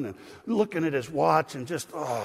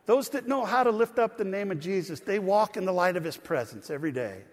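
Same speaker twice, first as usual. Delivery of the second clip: ordinary talk, nothing marked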